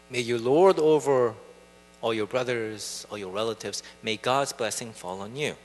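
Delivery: put-on an old father blessing his son, solemn and quiet. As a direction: lay the blessing on him gently.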